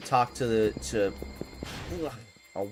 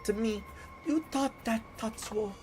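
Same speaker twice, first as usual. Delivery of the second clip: higher, hammed-up broken-English foreign accent; a foreign diva's shaky fake accent, higher pitched; questionable foreign accent; 5 semitones higher than usual. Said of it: "my questionable accent of the day".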